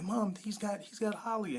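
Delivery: retold high-pitched cry to mom; urgent alarm. Son alerts his mom that his sister is taken, urgent alarm. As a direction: imitate a kid urgently alerting his mother.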